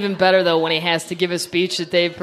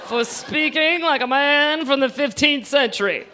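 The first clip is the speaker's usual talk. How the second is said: mock stiff John Kerry; 5 semitones higher than usual. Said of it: "come out and go".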